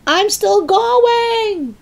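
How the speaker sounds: very high, drawn-out screech; old screechy voice. A narrator's reading. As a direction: screech it slowly from up above. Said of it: "came the old screechy voice".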